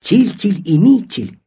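plain reading voice

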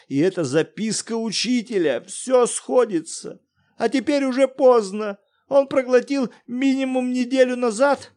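отчаянный крик; frantic shouting. Acted. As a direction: shout while pacing the room. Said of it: "выкрикивал".

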